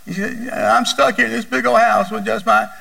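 imitating a weeping mother, pitch up; mock sobbing complaint; lightly voiced crying lady; tearful quote. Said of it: "And she was just crying".

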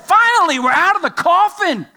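high, loud exultant cry; triumphant preacher's shout of relief; excited, jubilant. Acted. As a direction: proclaim it like someone just set free. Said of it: "well-fed and healthy and strong and excited".

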